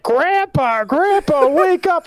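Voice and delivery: high-pitched mock child wail; imitated distressed kids. Retelling kids calling to their dead grandpa, high imitated wail. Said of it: "the kids are like".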